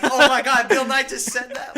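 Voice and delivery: high loud shocked laughing shout. Retold or imitated. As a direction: squeal it in delighted disbelief. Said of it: "His face is just like".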